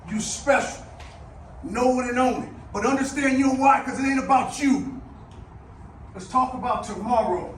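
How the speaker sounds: loud rousing shout; halftime pep speech. Real, not acted. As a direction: fire them up loudly. Rousing close.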